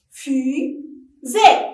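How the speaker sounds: "Fusée" as Fu, pause, sée; teacher's separated syllables on "Fusée". Shown as a demonstration. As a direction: clap out the two beats of "Fusée". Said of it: "frappez-le en syllabe".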